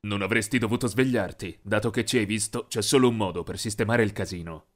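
low, soft sinister threat; cold captor's voice; menacing villain. Acted. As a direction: lean in and threaten him quietly. Villain threatens tied-up victim.

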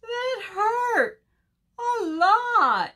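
high, loud tearful wail; hurt little bird crying out; moaning in pain.

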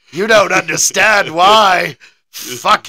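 badly faked Scottish accent, telling off the American; mock-angry half-hearted Scottish accent. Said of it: "neither of us can do a Scottish accent".

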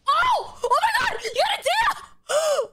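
very high, stretched squeals of disbelief; ecstatic shock. Real, not acted. Streamer sees a rare pull for Haley, ecstatic shriek. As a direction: shriek with genuine excitement.

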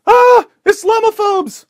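high, loud hysterical shriek; mock scream. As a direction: shriek it like a panicked reporter. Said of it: "and screams".

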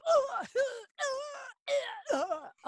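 long high wailing cry; long hit cry. Shown as a demonstration. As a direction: let the cry tail out. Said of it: "all those different vowel sounds".

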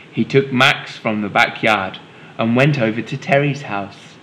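ordinary delivery

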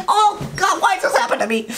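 high, exasperated groan at himself. Real, not acted. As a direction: throw your hands up, frustrated. Exasperated at own rambling.

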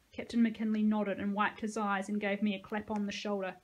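ordinary delivery

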